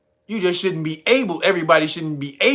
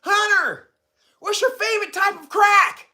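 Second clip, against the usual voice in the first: reporter voice; 13 semitones higher than usual. Retold or imitated. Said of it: "the reporter yelled out".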